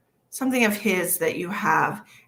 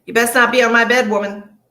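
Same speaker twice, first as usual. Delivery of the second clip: loud playful telling-off, a bit faster; mock scolding; 4 semitones higher, 9 dB louder than usual. Scolding whoever is on her bed, mock stern and loud.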